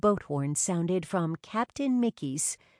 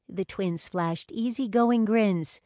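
ordinary delivery

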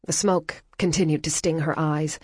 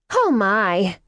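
bright, louder American woman's gasp; the flustered American girl blurts out her surprise; surprised American exclaim; 4 semitones higher, 5 dB louder than usual. Acted. Flustered American woman, loud startled exclaim.

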